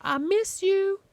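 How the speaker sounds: very high put-on voice; squeaky farewell. Streamer says goodbye to Popo, high squeaky call. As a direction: call it out in a falsetto.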